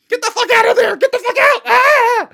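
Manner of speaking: high, loud mock-panic screaming; panicked shouting. Yelling at Kim as Adrian appears, panicked shouting. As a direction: shriek at the movie heroine to run.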